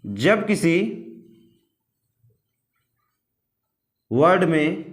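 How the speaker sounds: slow dictation with long pauses between phrases. Acted. Teacher dictating the rule for students to copy down, slow and spaced.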